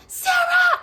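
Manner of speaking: very high, loud yell; piercing acted call-out; screaming for someone. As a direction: holler the name for a friend. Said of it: "I'm screaming for Sarah".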